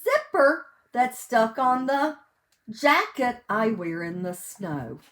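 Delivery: loud, sing-song picture-book reading; reading to children. Acted. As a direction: read each page big and bright for little listeners. Reads picture book aloud.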